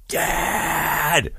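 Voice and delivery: high, drawn-out mock holler for Dad; imitated kid's yell. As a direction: imitate a son calling from downstairs. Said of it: "he'll yell, like, real loud".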